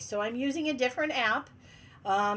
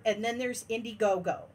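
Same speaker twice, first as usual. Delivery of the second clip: ordinary talk, nothing marked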